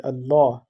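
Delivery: "Allah" with a heavy, thickened "l"; thicker lam. Shown as a demonstration. Teacher demonstrates the rule to student, "Allah" with thick lam. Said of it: "pronounced in a thicker voice".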